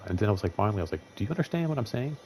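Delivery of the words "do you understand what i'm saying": pitched-up scolding question, rising at the end; talking down to a kid. Retold or imitated. Scolding a guy who kept crossing the line, like a child. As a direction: talk to him like a twelve-year-old. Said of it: "like he was, like, a twelve-year-old".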